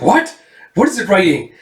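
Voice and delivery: high, urgent questioning; astonished, eager demand; acted surprise. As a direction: jump on the news, voice shooting up. Villain startled by the discovery.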